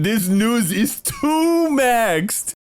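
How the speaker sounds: slow, high drawn-out mockery; mocking drawl. Real, not acted. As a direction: stretch it out in disbelief. Mocking the news.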